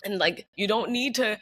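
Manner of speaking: normal talking voice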